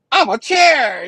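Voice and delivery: high, loud comic cry; dazed assistant's silly outburst. Acted. Drugged assistant announcing a delusion, high silly shout. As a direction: blurt it out high and daft.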